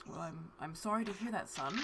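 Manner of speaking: quiet, lightly voiced reserved father; soft and restrained, with a sigh behind it. Father answering his son's account, quietly reserved.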